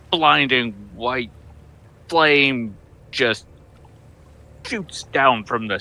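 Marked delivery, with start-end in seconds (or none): none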